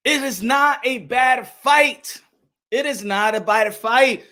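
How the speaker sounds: "not a bad fight" slow and spaced out; measured emphasis on "not" and "fight"; stressed, deliberate. Real, not acted. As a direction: lay each word of "not a bad fight" down separately. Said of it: "I wanna stress it out".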